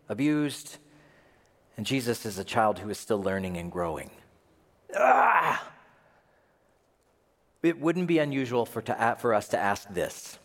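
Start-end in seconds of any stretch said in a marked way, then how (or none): 5.0-5.7 high, loud exasperated "ah"; frustrated cry. Acted. Preacher despairing at the slow wait, frustrated cry. Frustration at Jesus still growing up.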